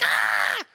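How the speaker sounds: short hammed-up scream; mock yell of terror; panicked scream. Overreacting husband to his wife, mock panicked scream. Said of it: "I'm the one like".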